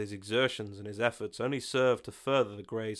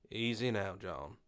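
quiet caution; 6 dB quieter than usual. Acted. Bill calms John quietly.

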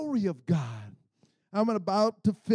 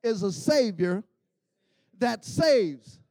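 short phrases with big pauses, punched loud; loud, swooping chant-like declaration; emphatic call-and-response litany; 3 semitones higher, 6 dB louder, slower than usual. Shown as a demonstration. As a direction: preach it phrase by phrase for the crowd to repeat. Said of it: "I like to say it like this".

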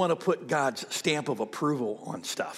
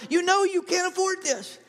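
a higher, otherworldly voice of conscience: "you can't afford this"; inner voice; 13 semitones higher than usual. Retold or imitated. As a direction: voice the nagging conscience up high. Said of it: "there's this voice going".

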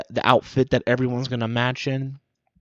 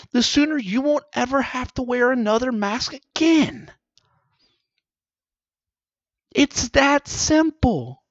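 high preachy scolding; mock scolding; 9 semitones higher than usual. Comic scolding the public about masks. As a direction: lecture them like children. Mock lecture to maskless people.

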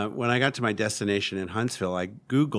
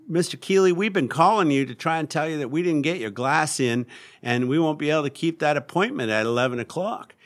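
put-on louder, higher Southern-accented voice; 7 semitones higher, 6 dB louder than usual. Glass shop breaking bad news to a customer, Southern accent. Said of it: "And the accent caused me to say".

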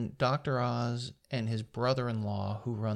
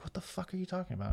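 quick, higher, stunned question; shocked disbelief; 8 semitones higher, 6 dB quieter, faster than usual. Retold or imitated. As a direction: blurt it out in disbelief, fast. Sam shocked at news.

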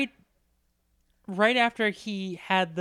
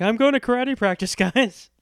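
louder, higher mock-eager announcement; eager kid quote; 5 semitones higher, 8 dB louder than usual. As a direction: imitate an oblivious kid proudly announcing plans. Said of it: "he basically was like".

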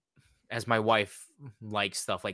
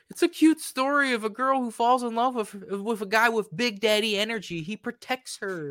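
mocking sweetness; 9 semitones higher than usual. Real, not acted. Mocking the appeal.

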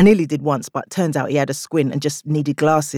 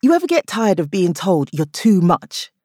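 punchy comic delivery to the crowd; stand-up bit; 6 semitones higher than usual. Acted. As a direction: sell the joke to the room. Stand-up routine within the story.

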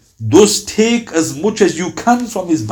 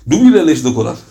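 ordinary talk, nothing marked

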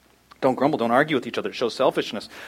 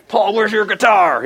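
mock complaint; 6 semitones higher, 5 dB louder, slower than usual. Grumbling prisoner mocked in jail.

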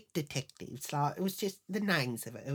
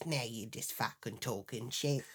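muttered dismissal; 5 semitones lower, 8 dB quieter than usual. Retold or imitated. Husband's dismissive retort.

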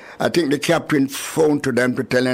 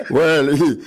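normal talking voice

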